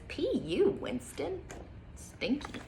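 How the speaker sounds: whispered teasing disgust; mock disgusted. Child teasing the farting dog, whispered mock disgust. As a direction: wrinkle your nose and whisper it. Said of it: "It says".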